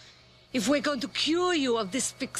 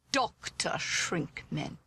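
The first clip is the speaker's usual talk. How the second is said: slow, low, ominous self-introduction; sinister doctor; 5 semitones lower, 3 dB quieter, slower than usual. Acted. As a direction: introduce yourself like a mad scientist.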